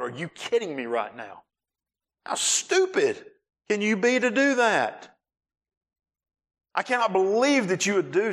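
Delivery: high-pitched mock scolding, cracking into a laugh; husband's hammed-up outburst at his stranded wife; mock outrage.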